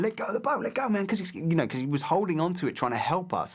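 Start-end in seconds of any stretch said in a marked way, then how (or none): 0.0-1.1 high urgent shout to let go; retold yell. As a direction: yell at the guy to let go of the boat. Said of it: "and I was going".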